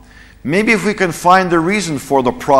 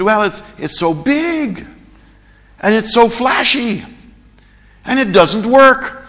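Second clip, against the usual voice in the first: much higher, halting mock foreign speaker; hesitant foreign reporter; 10 semitones higher than usual. Foreign reporter reluctantly answering the US reporter, halting and high. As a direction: stammer it out like someone short of English. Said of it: "His English wasn't that good".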